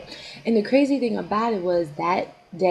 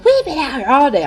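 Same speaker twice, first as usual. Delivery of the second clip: higher, louder mock outburst; going off again; 6 semitones higher, 7 dB louder than usual. Friend complains about the long day, mock angry outburst. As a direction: imitate her losing it. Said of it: "she's going off again".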